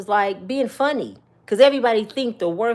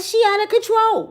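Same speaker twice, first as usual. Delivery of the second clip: mock outraged African man; 11 semitones higher, 5 dB louder than usual. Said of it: "He like".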